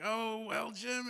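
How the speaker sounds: high, drawn-out "oh, well"; retold sheepish reply. Speaker stalling Jim about the job, sheepish and high.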